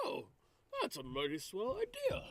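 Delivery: high, airy put-on voice; light, high-pitched adventurer; character voice. Player voices own character.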